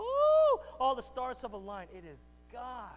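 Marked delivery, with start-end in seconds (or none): 0.0-1.9 a squealed high "Oh" then dreamy gushing; mock swooning romantic. Preacher mocks cheesy love-story talk, swooning high. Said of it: "we go".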